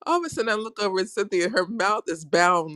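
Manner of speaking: usual speaking voice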